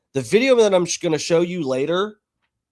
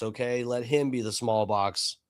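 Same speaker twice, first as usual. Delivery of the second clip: usual speaking voice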